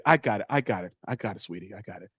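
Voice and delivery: low voice fading to very soft; calm father. Acted. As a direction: take over as a reassuring dad, low and quiet. Voicing the father stepping in.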